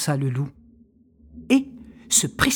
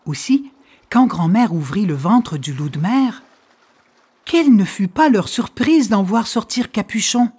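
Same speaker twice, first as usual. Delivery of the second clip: ordinary delivery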